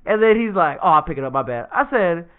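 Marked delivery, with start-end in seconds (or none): 0.8-1.7 low-pitched, offhand apology; laid-back acted concession; nonchalant little brother. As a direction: shrug it off, voice sinking. Plays brother's casual apology.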